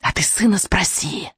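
slow, lightly hissed retort; a hint of sinister hiss; witch's hiss. A narrator's reading. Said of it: "прошипела ведьма".

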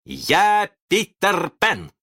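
high, slow proud proclamation; triumphant announcement. A narrator's reading. Boy reveals himself to the pirates, triumphant. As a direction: declare the name grandly, stretched out.

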